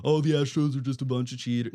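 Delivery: lightly mocking voice of the doubters; a hint of a whiny hater. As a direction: toss off the critics' line with a sneer. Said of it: "those people that are saying".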